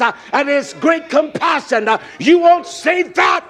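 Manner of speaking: high, loud, driving preacher cadence; shouted sermon climax with rhythmic "uh" breaks; full-force preaching. Real, not acted. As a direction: preach at full throttle, phrase after phrase.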